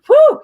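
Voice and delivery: high, loud whoo; whoo of heat. Real, not acted. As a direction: blow off the heat. Fanning herself, feeling hot.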